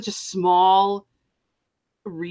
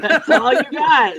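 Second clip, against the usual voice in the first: laughing through the line, louder and higher; 9 semitones higher, 8 dB louder, slower than usual. Real, not acted. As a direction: crack up while repeating it. Laughing repeat of joke.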